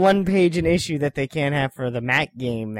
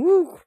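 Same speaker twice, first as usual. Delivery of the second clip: woo cheer; 4 dB louder than usual. Real, not acted. Cheer at a smell.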